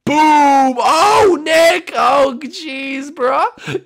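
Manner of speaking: loud, very high shout; excited yelling. Real, not acted. Gamer watching Nick get exploded, high excited yell. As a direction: yell the blast in high-pitched shock. TNT blows up under Nick.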